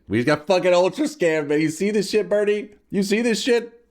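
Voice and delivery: building from annoyed to high outraged yelling; rising outrage. Real, not acted. Outraged at lost bets.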